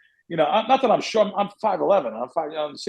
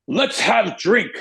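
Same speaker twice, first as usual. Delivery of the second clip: slow, higher-pitched mock Russian accent; European accent; 5 semitones higher, 3 dB louder, much slower than usual. Big stranger inviting the speaker for a drink, slow imitated European accent. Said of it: "not making fun of any accent".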